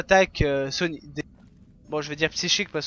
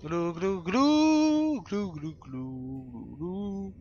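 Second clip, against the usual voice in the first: underwater distortion; 6 dB quieter, much slower than usual. Pretend. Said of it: "On parle sous l'eau".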